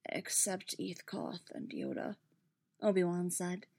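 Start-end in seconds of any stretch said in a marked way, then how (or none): 0.1-2.2 slow, much softer raw voice; raw, scraping voice. A narrator's reading. Prisoner names absent council members, voice scraping raw. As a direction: scrape the words out quietly and slowly. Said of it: "his voice scraping raw over the words".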